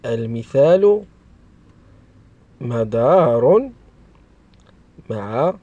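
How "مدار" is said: slow, drawn-out dictation of a word; slow enunciation. Shown as a demonstration. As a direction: stretch each syllable for young learners. Teacher sounds out example word. (نطق بطيء ممدود للتعليم)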